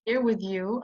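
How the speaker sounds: ordinary delivery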